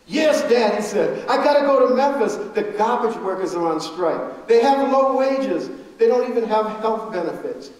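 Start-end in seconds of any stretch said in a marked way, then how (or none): 0.1-0.7 loud eager yes, dad; high, loud earnest son; earnest reply. Retold or imitated. Son pleading with father. 1.3-7.7 loud, urgent insisting; higher, louder pleading son; impassioned insistence. Retold or imitated.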